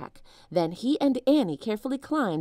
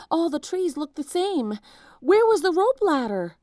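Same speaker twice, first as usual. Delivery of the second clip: much higher, louder panicked voice; lost and scared, racing; frantic; 10 semitones higher, 6 dB louder than usual. A narrator's reading. Lost while fleeing.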